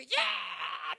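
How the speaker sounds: sharp high yell of pain; a husband yelling at his wife after scalding his tongue; startled yell. Retold or imitated. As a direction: yell as hot water burns your tongue. Burned tongue, husband yells.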